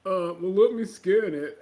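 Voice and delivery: slow, loud dopey clerk; hesitant, drawn-out mock dimness; clueless store clerk. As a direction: imitate a boy unsure what to do. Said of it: "He was like".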